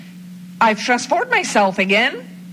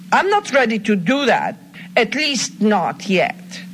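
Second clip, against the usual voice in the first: normal talking voice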